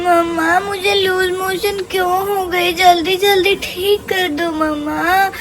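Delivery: ordinary delivery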